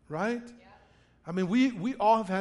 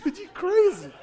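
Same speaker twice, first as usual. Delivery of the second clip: high, laughing, a bit louder; laughing disbelief; 11 semitones higher, 5 dB louder than usual. Retold or imitated. Pastor laughing at his friend on the ice, high laughing disbelief. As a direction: laugh it out at the madman.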